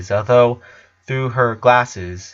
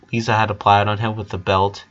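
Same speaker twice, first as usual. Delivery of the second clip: ordinary delivery, nothing marked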